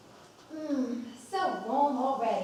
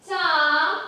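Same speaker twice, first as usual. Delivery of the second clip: loud call, higher, across the house; 6 semitones higher, 14 dB louder than usual. Acted. Mother calling her son to the table, loud call. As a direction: summon him from the other room.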